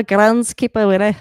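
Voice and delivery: put-on Scottish accent, lightly done. Football commentator playing with a favorite word, Scottish accent. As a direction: try the word in a Scottish brogue. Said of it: "word I can say in a Scottish accent".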